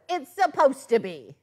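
telling the customer the crooked die is supposed to be crooked, firmly; matter-of-fact retort. Retold or imitated. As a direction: set the caller straight. Answering customer's complaint.

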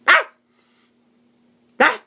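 sudden loud mock yell; imitating a yell. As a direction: blurt it out like a random outburst. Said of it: "just randomly just yells".

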